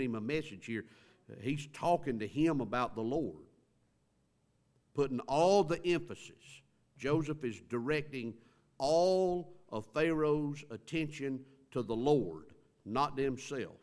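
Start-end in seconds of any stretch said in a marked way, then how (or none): 4.9-11.9 a preacher's push on the key line; preaching emphasis. Real, not acted. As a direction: lean on every word.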